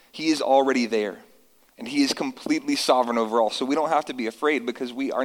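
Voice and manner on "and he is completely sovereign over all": ordinary talk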